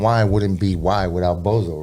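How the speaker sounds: normal talking voice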